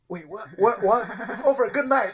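high, laughing, mock confused; giggling through it. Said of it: "you're like".

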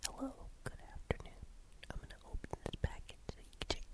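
fast breathy ASMR whisper. Retold or imitated. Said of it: "people who just whisper into the mic".

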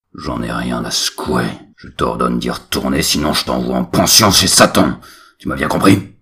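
furious father. Acted. Father orders son.

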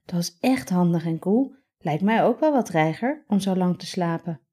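ordinary delivery

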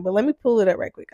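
normal talking voice

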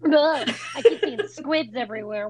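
ordinary talk, nothing marked

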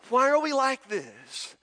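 ordinary talk, nothing marked

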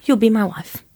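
lightly put-on foreign accent; mimicked proposal, foreign accent; imitated non-English accent. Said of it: "I love doing accents".

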